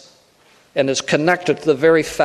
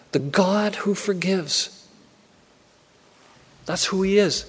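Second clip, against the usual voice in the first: softly stressed; 2 semitones higher, 5 dB quieter than usual. Real, not acted. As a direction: let it sink in gently for the congregation. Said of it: "I want to stress this tonight".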